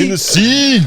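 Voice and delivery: high-pitched playful imitation; squeaky Hennessy call. Topping the echo even higher.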